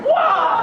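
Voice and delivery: high, loud squawking wah; wah cry. Acted. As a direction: blurt out a wah. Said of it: "Start your sentences with "wah".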